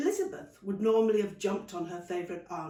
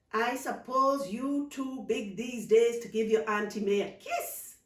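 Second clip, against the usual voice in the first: playful Caribbean aunt, teasing; teasing auntie; 3 semitones higher, 5 dB louder than usual. Acted. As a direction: tease the girl like a fond aunt. Auntie May voiced teasing.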